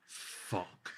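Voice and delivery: whispered curse under the breath; panicked whisper. Retold or imitated. Cook whispering a curse as the chef arrives. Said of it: "My fucking arse just fell out".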